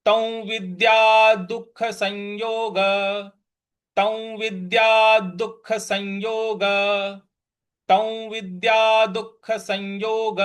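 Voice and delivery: slow, even "तौ विद्या दुख संयोग" repeated; slow recitation demo. Shown as a demonstration. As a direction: say "संयोग" with the nasal joined to य. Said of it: "इसका उच्चारण पूरा बन रहा है".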